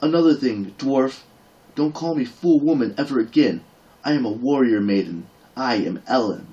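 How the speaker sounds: high, loud indignant scolding; a proud warrior maid demanding respect; indignant anger. Acted. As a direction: put him in his place. Ellen angrily scolds the dwarf.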